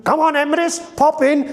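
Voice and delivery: very high little lamb; the baby lamb calling Emrys in. Shown as a demonstration. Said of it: "is the baby lamb".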